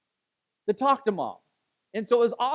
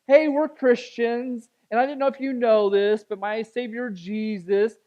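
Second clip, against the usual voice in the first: mock preachy pitch; 4 semitones higher, 7 dB louder than usual. Mocking pushy preaching approach.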